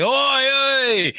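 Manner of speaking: high, drawn-out Cockney holler. Acted. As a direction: call out "oi" like a cartoon Londoner. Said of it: "we only sound like that".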